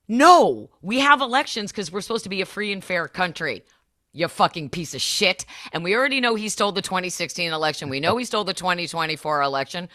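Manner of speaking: ordinary talk, nothing marked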